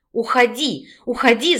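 restrained narrated shout; mock yell. A narrator's reading. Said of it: "закричала Раина". (сдержанно изображённый крик)

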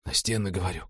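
faint repeated whisper; hardly audible, insisting weakly; barely audible. A narrator's reading. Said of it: "повторил он чуть слышно".

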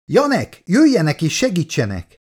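high, desperate call for help; desperate cry. A narrator's reading. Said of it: "kiáltott fel kétségbeesve Shaw doktor". (kétségbeesett, magas kiáltás)

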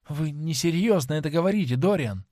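plain reading voice

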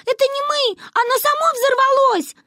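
put-on very high, panicked child shouting; frantic high-pitched protest; Манюня. A narrator's reading. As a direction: yell your excuse in a squealing girl's voice. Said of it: "кричала Манюня".

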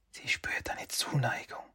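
high, hushed whispering voice; whispering spirit. Acted. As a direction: breathe it into his ear like a tempting spirit. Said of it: "Die säuselnde Stimme Kitsunes".

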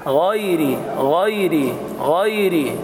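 غيري with a thin, light, uncoloured ra. Shown as a demonstration. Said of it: "الراء مرققة".

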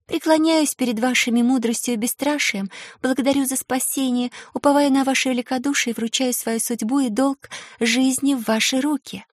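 put-on a shy young girl, lilting and formal; melodic girl's voice. A narrator's reading. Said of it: "Её мелодичный голосок напоминал журчание ручейка".

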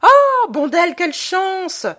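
high, loud cry of glad surprise; delighted exclamation. A narrator's reading. Tancré greets Bondel arriving at his door, a loud delighted cry. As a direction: burst out at the happy sight of an old friend. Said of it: "s'écria".

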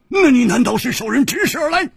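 loud, high accusing question; forceful, high-pitched challenge; pressing interrogation. Acted. Interrogator presses the cornered man.

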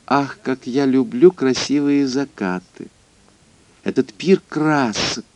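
high, airy swooning sigh; dreamy lady voice. Acted. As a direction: gush over the sunset. Mrs Moses gushes dreamily. (мечтательный дамский голосок)

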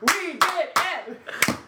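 high, drawn-out cheer; mock celebration. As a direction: stretch each word into a triumphant high cheer. Mock celebration of a friend's confession.

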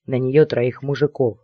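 usual speaking voice

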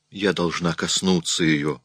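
ordinary delivery, nothing marked

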